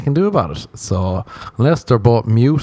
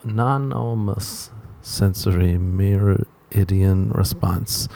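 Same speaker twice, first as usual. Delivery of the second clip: careful slow reading; 6 dB quieter, much slower than usual. Shown as a demonstration. Reading the term again slowly.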